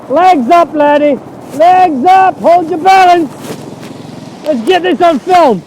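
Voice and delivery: loud, high excited calls; excited shouting. Real, not acted. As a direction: cheer yourself on, yelling. Shouting encouragement through flood.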